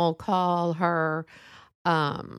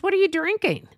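high, loud, prying question; nosy loud question; 10 semitones higher, 4 dB louder than usual. Retold or imitated. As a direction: call it out like a nosy busybody. Loud busybody quizzes Sissy.

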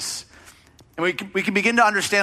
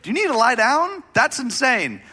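high, incredulous mock questioning; mock disbelief; 8 semitones higher than usual. Preacher mimicking reaction to someone unstressed, high mock disbelief. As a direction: ham up baffled amazement at a calm person. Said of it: "and you're like".

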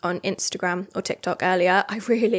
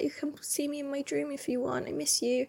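tender whisper; 5 semitones higher, 10 dB quieter than usual. Retold or imitated. Speaking to her late dog at night.

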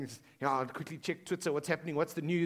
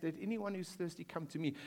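hushed scripture quote; 9 dB quieter than usual. Retold or imitated. Preacher quoting Jesus's real words to the congregation, hushed. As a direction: read the true verse softly, with reverence. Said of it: "He says".

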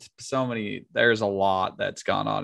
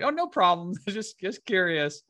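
mock innocent; 9 semitones higher than usual. Tim retold reply.

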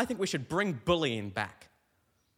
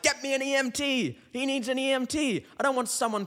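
panicked call; 9 semitones higher than usual. Retold or imitated. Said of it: "my mate were like".

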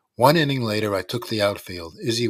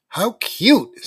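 mocking; 12 semitones higher, 9 dB louder than usual. A narrator's reading. Danny mocking the kids.

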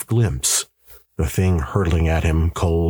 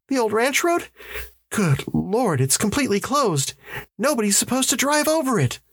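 much higher voice for an alarmed wife; protesting wife. A narrator's reading. Wife protesting the closed ranch road to her husband, alarmed. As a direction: play a wife objecting in disbelief. Voices Janet objecting to the route.

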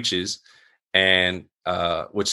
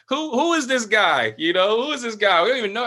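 imitating incredulous friends, pitched way up; baffled disbelief; 10 semitones higher than usual. Friends question who he has become, incredulous. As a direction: act out pals who don't recognize you. Friends react to churchgoing news.

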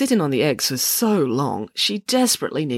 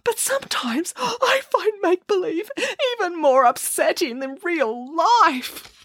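sobbing Boris; 12 semitones higher than usual. A narrator's reading. Boris sobs an apology to Nanny Piggins. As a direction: sob out the apology. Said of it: "sobbed Boris".

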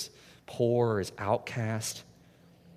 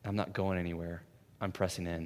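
soft, low, gentle promise; a quiet tender vow; tender reassurance; 5 semitones lower, 6 dB quieter than usual. Retold or imitated. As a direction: lean in and reassure. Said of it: "she leans in and says".

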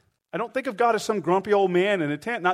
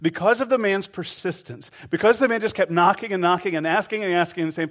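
ordinary talk, nothing marked

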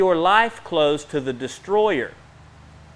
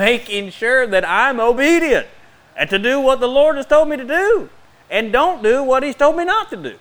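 rising preach; 8 semitones higher, 5 dB louder than usual. Acted. Preaching climbs to full force.

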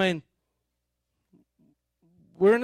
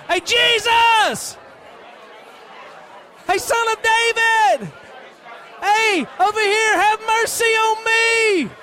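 loud, high, slow desperate shout; shouting for Jesus; 11 semitones higher, 3 dB louder, slower than usual. Acted. Blind beggar shouting to Jesus over the crowd, desperate. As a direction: cry out across the crowd for mercy.

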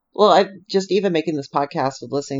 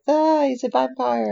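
mock spooked fan; 11 semitones higher, slower than usual. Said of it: "People are like".

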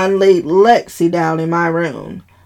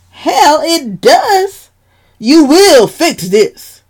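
high, loud, slow command; angry demand; 10 semitones higher, 6 dB louder, slower than usual. Acted. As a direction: order it, furious. Dad demanding in quarrel.

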